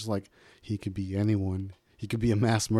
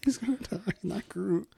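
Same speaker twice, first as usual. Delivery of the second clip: laughing; 10 semitones higher, 4 dB quieter than usual. Real, not acted. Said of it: "Made me cry".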